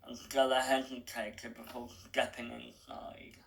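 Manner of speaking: ordinary delivery, nothing marked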